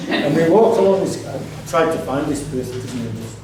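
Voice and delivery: normal talking voice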